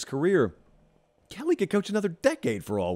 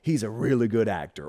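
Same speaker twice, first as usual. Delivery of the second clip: 1 John Malkovich impression; 4 semitones lower than usual. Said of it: "get down to the John Malkovich part".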